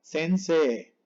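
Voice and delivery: sensei ending on a long E; silent final I. Shown as a demonstration. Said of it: "no suena la I del final".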